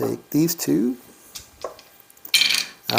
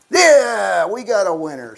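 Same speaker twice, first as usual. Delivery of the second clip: higher, louder burst of joy; excited cheer; 5 semitones higher, 4 dB louder than usual. Real, not acted. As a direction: shout it like you just won. Winning roll.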